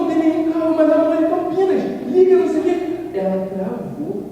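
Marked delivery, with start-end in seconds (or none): 0.0-2.7 high, loud flustered protest; panicked wife. Retold or imitated. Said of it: "Ela falou". (esposa nervosa e aflita falando alto)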